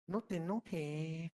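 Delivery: slow, very soft, exaggerated hush; mock soothing. Speaker mocks someone telling an angry friend to calm down, slow and hushed. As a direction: coo it slowly like a patronizing calmer.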